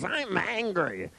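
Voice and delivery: high, drawn-out imitation of anger; mock anger. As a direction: imitate a man obediently wailing his anger. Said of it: "Tell your mother you're angry".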